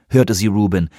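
ordinary delivery, nothing marked

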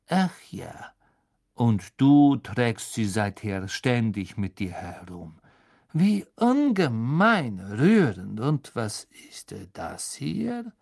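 slow, drawn-out sneer; sneering mockery. Snape mocking Harry's sentimental gift, slow and sneering. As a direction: drip with contempt.

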